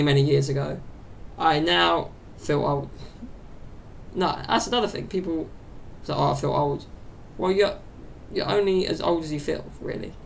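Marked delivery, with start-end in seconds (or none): none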